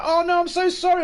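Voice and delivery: exaggerated squeaky apology; mock apologetic parent. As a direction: ham up a guilty parent's apology. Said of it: "and you're like".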